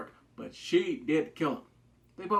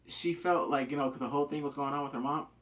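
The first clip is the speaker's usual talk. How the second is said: ordinary delivery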